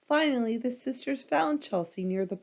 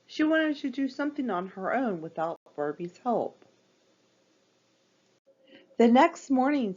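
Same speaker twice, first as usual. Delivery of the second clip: plain reading voice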